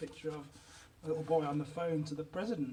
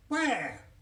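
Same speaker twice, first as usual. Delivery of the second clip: louder, falling demand; exasperated question; 3 semitones higher, 4 dB louder than usual. A narrator's reading. Mummy hunting her invisible child, exasperated. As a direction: demand it with mounting bafflement. Mother asks again, more exasperated.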